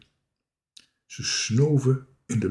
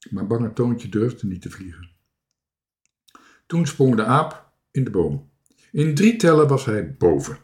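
plain reading voice

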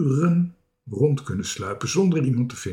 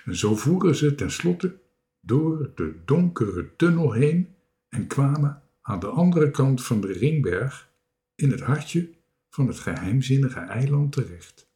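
ordinary delivery

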